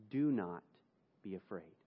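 soft, tender assurance; gentle reassurance. Acted. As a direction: ease a terrified man's fear. David reassures the doomed man.

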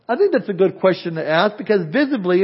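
ordinary talk, nothing marked

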